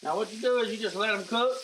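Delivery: normal talking voice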